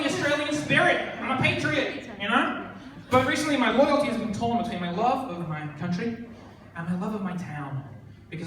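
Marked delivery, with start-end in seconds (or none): none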